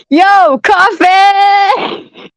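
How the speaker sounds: high shout through laughter; laughing shout. Real, not acted. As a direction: yell the name while cracking up. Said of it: "I'm crying right now".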